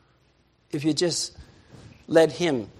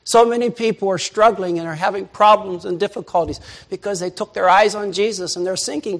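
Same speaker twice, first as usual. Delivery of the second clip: normal talking voice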